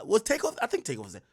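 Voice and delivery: ordinary delivery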